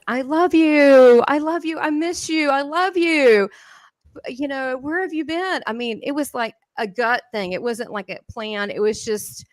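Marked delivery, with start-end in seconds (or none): 0.1-5.6 high, slow, choked-up calling out of love; tearful love. Retold or imitated. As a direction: pour out longing as if near tears. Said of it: "I was so touched. I wanted to cry".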